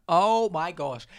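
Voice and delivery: loud, slow "oh my gosh"; swooning. Real, not acted. Telling viewers about the elevator man, swooning loudly. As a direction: swoon over him out loud. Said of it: "swooning at the hottest man".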